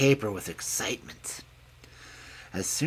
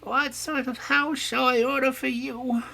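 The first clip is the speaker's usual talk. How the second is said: put-on high, gentle voice of an old mother pig; mother pig telling her piglets she is growing old. A narrator's reading. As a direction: play the frail old sow advising her children. Mother pig asks Blackie.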